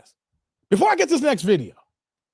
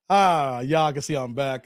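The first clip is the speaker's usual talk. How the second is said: ordinary talk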